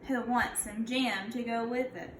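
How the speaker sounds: put-on storybook read-aloud for kids; a gentle teacher reading to her class; reading to children. Teacher reads picture book aloud.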